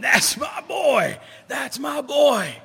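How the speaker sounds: high, loud and slow, bursting with pride; proud father's shout. Retold or imitated. Father in the stands shouting over the crowd, proud high yell. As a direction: bellow it across the ballpark. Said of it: "pointing his finger, going".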